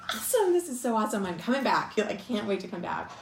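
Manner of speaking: loud, high, thrilled exclamation breaking into a laugh. Retold or imitated. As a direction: burst out with joy, close to a squeal. Thrilled reaction to the blessing.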